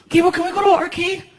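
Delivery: put-on high, whiny kid voice; little kid. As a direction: tattle like a small boy. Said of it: "let's do it".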